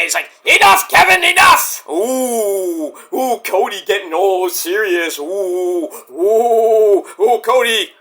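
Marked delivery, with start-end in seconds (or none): 0.4-1.9 high, loud retold yell; mad outburst. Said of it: "Cody gets all mad". 1.9-7.9 long drawn-out teasing oohs; mock awe. Real, not acted. Teasing Cody for getting serious.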